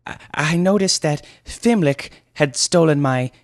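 high, loud, halting voice; hesitant youth. Acted. Flustered youth reporting the thief, hesitant. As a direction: stammer out the accusation. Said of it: "Pipkin hesitated".

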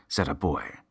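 ordinary delivery

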